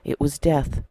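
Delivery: a preacher narrating a parable to the church, in an expressive storytelling voice; story narration. A narrator's reading. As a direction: tell it like a fireside story to the congregation. Sermon told as a story.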